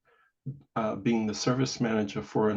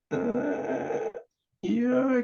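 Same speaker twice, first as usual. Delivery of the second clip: drawn-out, high-pitched uncertainty; hesitant puzzlement; 12 semitones higher than usual. Retold or imitated. Sibling unsure why Dad must be called, hesitant. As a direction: stall on a high, wary note. Puzzled reply to brother's cryptic advice.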